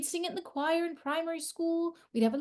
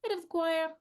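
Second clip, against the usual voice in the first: ordinary talk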